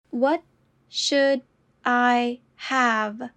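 slow, each word apart. Shown as a demonstration. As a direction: say it slowly, word by word. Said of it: "repetition drill, slowed take".

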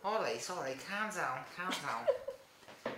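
hammed-up Liverpool accent, drawn out; Scouse accent. Shown as a demonstration. Showing off a Liverpool accent on request, in mock Scouse. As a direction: put on a stock comic Scouse accent. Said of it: "Want me to do Scouse accents?".

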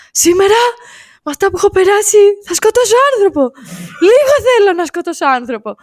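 high, ranting act of a madman; playing crazy. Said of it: "αρχίζει και το παίζει τρελός".

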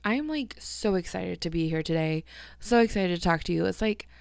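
normal talking voice

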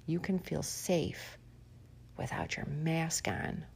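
soft, slow sarcastic whisper; mock reassurance. Host mocks mask-free listeners in a soft sarcastic whisper. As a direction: soothe them mockingly, barely voiced.

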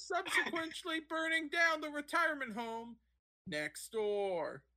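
high-pitched parody of a TV reporter; hammed-up field reporter delivery; sideline reporter. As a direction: overdo the on-the-scene report, punching mid-sentence words. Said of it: "that's how every sideline reporter fucking talks".

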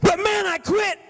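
hammed-up high whine of a quitter; whiny young man. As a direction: play the sulking teenager. Young man insisting he quit.

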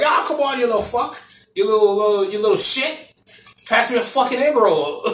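high-pitched, hammed-up Australian insults; mock Australian accent, pitched well up. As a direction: do a rough imitation of an Australian heckler. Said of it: "like, the Australian accent".

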